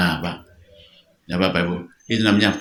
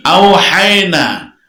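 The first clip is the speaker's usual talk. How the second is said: "Auhayna" slow, higher and louder, falling; stressed Arabic word; 7 semitones higher, 5 dB louder, slower than usual. Shown as a demonstration.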